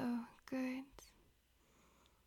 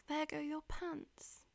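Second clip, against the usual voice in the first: normal talking voice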